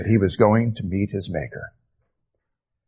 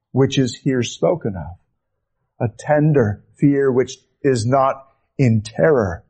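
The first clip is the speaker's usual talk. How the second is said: ordinary delivery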